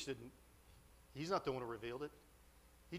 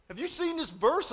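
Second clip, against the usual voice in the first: high, loud mock astonishment; incredulous yelling; 13 semitones higher, 10 dB louder than usual. Classmate asking whether he saw the verse, incredulous yelling. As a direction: yell the question in disbelief.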